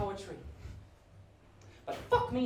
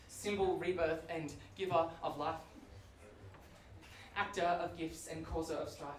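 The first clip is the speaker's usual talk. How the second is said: lower, softer solemn recital; mock grave poem; 4 semitones lower, 6 dB quieter than usual. Comedian reading a water poem to the crowd, mock solemn. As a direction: intone it like a deep poet.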